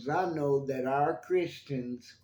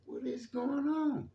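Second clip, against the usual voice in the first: high, puzzled mock question; bewildered; 12 semitones higher than usual. Remembering church as a child, high bewildered question. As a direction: voice his old confusion, pitched up. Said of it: "I used to be like".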